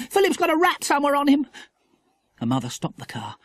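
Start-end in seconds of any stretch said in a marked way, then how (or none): 0.1-1.5 put-on high, urgent child's complaint; pitched-up panicky tattling; horrified girl. A narrator's reading. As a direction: blurt it out in alarm. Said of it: "watched him in horror".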